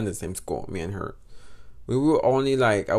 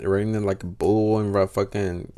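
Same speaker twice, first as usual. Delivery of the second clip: ordinary delivery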